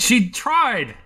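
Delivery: high, protesting cry; indignant defence. Acted. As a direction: defend a friend in acted outrage. Acted quarrel, [name] defends friend.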